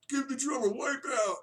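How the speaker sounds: high, shouted-sounding mock heckle; mock crowd request. Teacher mimicking fans who keep requesting Wipeout, mock heckle. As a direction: play the pushy fan calling out a request.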